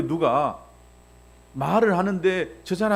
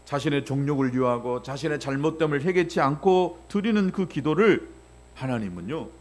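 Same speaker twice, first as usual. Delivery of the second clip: ordinary talk, nothing marked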